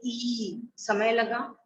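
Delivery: ई stretched out long and slow; long drawn vowel. Shown as a demonstration. Teacher showing students a long vowel, slowly drawn out. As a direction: hold the ई vowel to show a long sound. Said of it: "ए में हमें इतना समय नहीं लगा जितना में आ में लगा".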